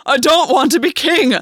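high, loud furious roar. A narrator's reading. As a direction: roar it out in fury. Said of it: "Gwen roared".

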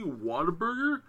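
"Whataburger?" asked high in disbelief; can't believe "Whataburger?", pitch way up; incredulous. Retold or imitated. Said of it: "Conrad was like".

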